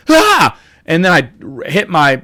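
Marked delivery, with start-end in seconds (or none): 0.1-0.6 sudden high yelp of alarm, much higher and louder; short shocked shout; startled yell. Retold or imitated. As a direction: yelp as the car backs into you. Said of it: "I yelled, I went".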